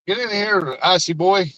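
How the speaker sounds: loud high call to come inside. Real, not acted. Owner calling dog inside, loud and high.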